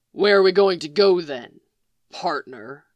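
gruff sneer at his partner. A narrator's reading. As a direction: spit out partner like it tastes bad. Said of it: "He sneered the last word".

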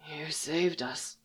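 faint, slow rasping voice; barely there, hoarse; weak rasp. A narrator's reading. Jaskier weak after rasping.

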